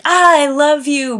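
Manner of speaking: loud, high, frantic I love you; shouted I love you, high and loud; chaotic scream. Retold or imitated. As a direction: scream it out in chaos. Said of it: "before it was screaming and kind of, like, chaos".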